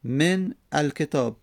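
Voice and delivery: من الکتاب slow and split, "men... alketāb"; two separate words, من / الکتاب; unlinked silent n. Shown as a demonstration. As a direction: stop on the n of من, then الکتاب. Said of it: "بعد از من چی اومده".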